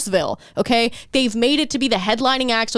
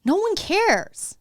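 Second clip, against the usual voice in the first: high-pitched, drawn-out scolding; slow and high, hammering the point home; emphatic dismissal; 8 semitones higher, slower than usual. Real, not acted. Comic telling off a needy opener, emphatic dismissal.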